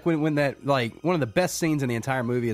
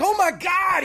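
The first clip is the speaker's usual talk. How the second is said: high, loud mock horrified cry; viewer's shock; 5 dB louder, slower than usual. Fan acting the audience's shock at the attack, high shout. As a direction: gasp it out in horror. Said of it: "You're like".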